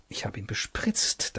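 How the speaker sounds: unvoiced whispered thought. A narrator's reading. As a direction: whisper it to yourself.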